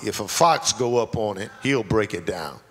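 low sneering taunt; lightly voiced scornful mockery; mocking. Tobiah mocking the builders, low sneering taunt. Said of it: "listen to the mockery".

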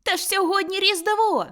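put-on high, loud boy's reply; amazed exclamation. A narrator's reading. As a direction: exclaim it as the obvious answer. Boy answers, amazed at the question.

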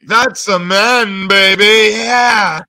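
slow, loud, drawn-out mock voice; hammed-up announcement. Mocking voice claim, slow loud.

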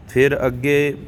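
usual speaking voice

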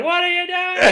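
far-off screamed line, very high; mock distorted mic shout; distant distorted shout. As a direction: yell like a voice behind a pillar. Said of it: "his audio is distorted ... in the distance".

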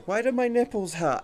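puzzled complaint. Retold or imitated. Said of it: "you go".

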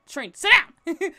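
loud, slow, firm command; slow stern mock scolding. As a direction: tell her off like a strict friend. Friends scold her.

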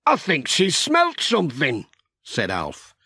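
a tired farmer, hopeful for tea. A narrator's reading.

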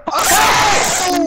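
loud high outburst; explosive exclamation; shocked shout. Real, not acted. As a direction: yell it out in shock. Reacting to play.

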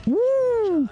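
a gleeful, high cheer; excited whoop. Real, not acted. Streamer cheering at the villain's speech, whooping.

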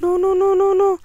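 high, panicked pleading no. Real, not acted. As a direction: beg in rising panic. Panicking at Slender Man.

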